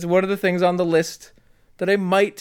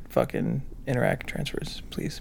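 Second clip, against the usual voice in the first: whispered aside, no voice; conspiratorial whispered plea; 2 semitones lower, 7 dB quieter than usual. Pretend. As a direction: hiss the ask under your breath.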